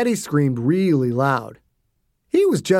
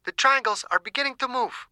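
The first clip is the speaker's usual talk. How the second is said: clinical woman; 9 semitones higher, 5 dB quieter than usual. A narrator's reading. Scientist logging observation.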